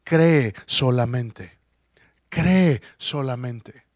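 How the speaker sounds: slow, weighty scripture line; slow solemn repeat; slow emphasis. Shown as a demonstration. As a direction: let each word land. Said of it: "repeats the verse slowly".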